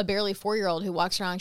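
ordinary talk, nothing marked

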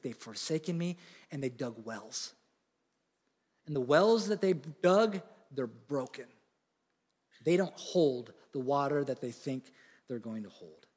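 soft, low, grieving lament; heartbroken God. Acted. Preacher voicing God, a touch heartbroken and low.

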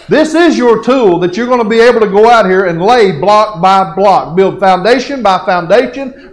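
loud, high, driving pulpit delivery; full-force preaching. Real, not acted. Preacher urging the church to reach the lost, full-force preaching. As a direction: drive every phrase home at full preaching volume.

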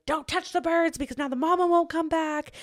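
high-pitched mock warning; mimicked online scolds. Mimicking people messaging her warnings.

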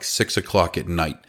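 usual speaking voice

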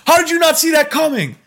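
high, loud exasperated parent voice; scolding dad. Retold or imitated. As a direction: scold the kid like an exasperated father. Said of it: "I'm like".

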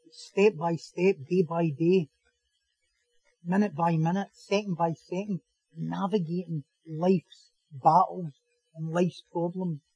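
low, soft, drawn-out phrases; slow and reflective. Real, not acted. Preacher describing lives changing gradually, slow and earnest. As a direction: measure out each step quietly.

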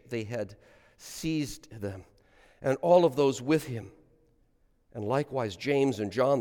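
solemn liturgical reading aloud; gospel reading. Acted. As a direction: intone the gospel for the congregation.

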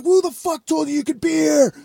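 high-pitched, strained mock yell; imitated angry shout. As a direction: act out an irate man chasing off a trespasser. Retelling angry man confronting him.

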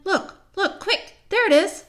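high, loud urgent pointing out; excited call. Acted. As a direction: spot it and shout, thrilled. Spotting the creature, calling out.

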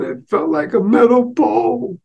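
put-on a teary kid explaining; little boy. Boy tells mother what he was hit with, high and slow.

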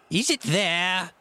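high, slow demanding roar; Pew roaring. A narrator's reading. Blind beggar to man at the window, roared demand. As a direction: roar the question up at them. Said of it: "roared Pew".

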